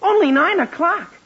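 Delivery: taken aback by the hour; surprised. Acted. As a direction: exclaim it in disbelief.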